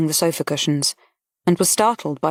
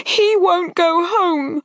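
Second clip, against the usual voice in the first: higher, slow, anxious protest; insist, fearful and higher; young lady protesting, higher and slow; worried young woman; 13 semitones higher, 4 dB louder, much slower than usual. A narrator's reading. Harriet objects.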